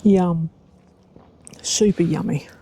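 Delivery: chewing strawberries while talking; mouth full. Real, not acted. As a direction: talk through a mouthful of strawberry. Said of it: "talking with my mouth full".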